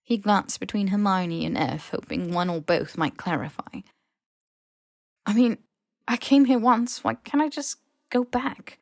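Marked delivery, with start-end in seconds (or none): 5.3-8.8 higher, louder frustrated asking; exasperated plea. A narrator's reading. Harry pressing Hermione and F, rising frustrated plea. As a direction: push the question, getting worked up.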